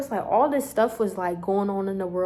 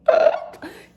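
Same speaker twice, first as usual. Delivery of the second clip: sudden squeaky laugh of panic; high nervous laugh; 9 dB louder than usual. Real, not acted. Nervous burst on heart stopping.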